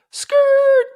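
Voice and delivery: piercing, high-pitched screech of skidding tires; imitated tire screech. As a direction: screech loudly like brakes locking up. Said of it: "I hear".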